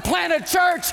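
high, loud shout of God's order; shouted command. Acted. Pastor shouting God's order to his church. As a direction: call it out like a command from heaven.

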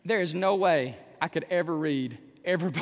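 ordinary talk, nothing marked

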